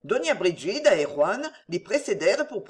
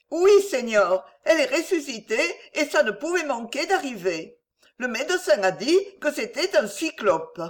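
put-on higher-pitched, insistent old aunt; unintimidated beggar woman; 6 semitones higher than usual. A narrator's reading. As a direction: answer back in a high, undaunted voice. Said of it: "ne se laissait jamais intimider".

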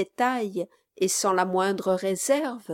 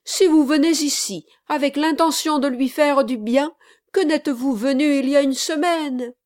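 grieved reproach; 6 semitones higher, 5 dB louder than usual. Acted. Bride reproaches gentleman.

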